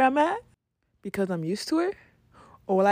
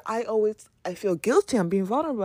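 ordinary talk

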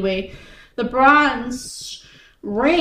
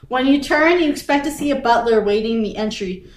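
normal talking voice

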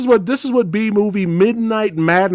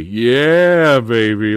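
long drawn-out yeah, dropping off; relishing cheer; 5 semitones lower, much slower than usual. Real, not acted. Host admires the bazooka blast, relishing cheer. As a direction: savor it like a delighted fan.

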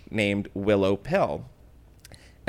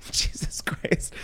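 whispering; 5 dB quieter, slower than usual. Real, not acted. Shocked reaction to friend's joke.

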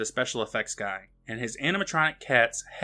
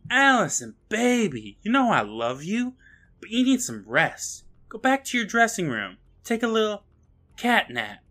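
placating director; 7 semitones higher than usual. Retold or imitated. Director sending actress off to rest, mock placating. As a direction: act out a director soothing his young star. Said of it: "puts his arm around her, telling her".

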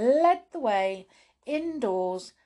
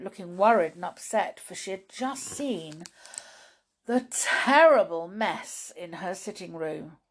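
ordinary delivery

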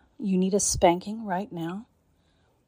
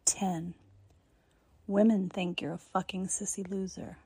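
ordinary delivery

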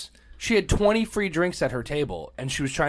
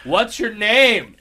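slowed, loud and higher, spelling it out for someone hard of hearing; slow loud repeat; 8 semitones higher, 9 dB louder, much slower than usual. Retold or imitated. Asking the barber his name again: slow, loud repeat. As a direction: drag each word out, loud, as if he can't hear you. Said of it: "He's like".

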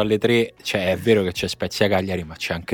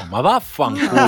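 sudden laughing curse, much higher; explosive italian cursing; 4 dB louder than usual. Real, not acted. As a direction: blurt out a stunned swear. Outburst at bad prediction.

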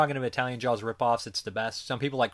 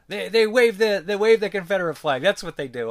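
mocking voice; 9 semitones higher, 8 dB louder than usual. Mocking Italian idea of Americans.

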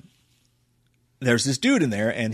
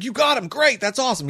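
excited relief; 10 semitones higher than usual. Retold or imitated. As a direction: celebrate the catch. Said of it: "He's like".

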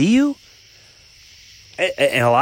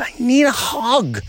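high-pitched retold argument; mock-aggrieved high retort; mildly angry retort; 10 semitones higher than usual. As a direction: plead it back, annoyed, pitched up. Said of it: "I got angry".

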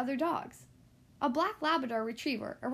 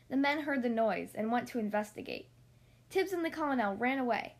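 usual speaking voice

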